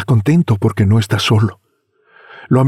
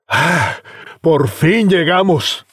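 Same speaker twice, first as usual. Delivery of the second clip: relieved exclaim; 7 semitones higher, much slower than usual. Acted. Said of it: "suspiro de satisfacción y exclama".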